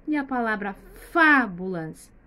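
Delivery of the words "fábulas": "fábulas" with a loud, drawn-out "fá"; stressed first syllable. Shown as a demonstration. Teacher asking where the stress is, "fábulas" with punched "fá". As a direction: lean hard on "fá" in "fábulas". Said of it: "que a sílaba está mais forte".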